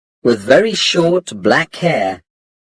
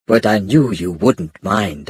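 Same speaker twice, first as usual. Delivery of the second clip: ordinary delivery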